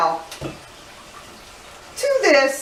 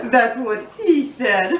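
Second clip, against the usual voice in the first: slow, through laughter; laughing; slower than usual. Real, not acted. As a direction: laugh while saying it. Laughing at own joke.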